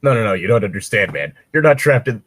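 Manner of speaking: normal talking voice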